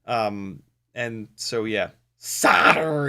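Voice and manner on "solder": slow, drawn-out "solder" as SAAH-derr; hammed-up American pronunciation. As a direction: stretch "solder" with silent L and long R.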